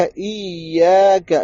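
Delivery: ایاک with the doubled ya held long; stretched shadda. Shown as a demonstration. Teacher showing the shadda must be stretched, slowly. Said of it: "شدہ آ رہا ہے".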